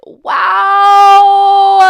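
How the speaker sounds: high, loud mock-amazed wail; mock shout. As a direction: shriek it in fake awe. Sarcastic reaction to his plans.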